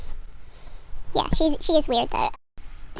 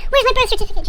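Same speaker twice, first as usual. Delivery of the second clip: high, louder mock panic; frantic demand; 9 semitones higher, 5 dB louder, faster than usual. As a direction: act out a frantic teenage search. Girl frantically searches father's study.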